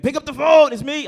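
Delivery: high full-volume holler; yelling inmate. Acted. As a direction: shout urgently over the recording. Inmate yelling over automated call.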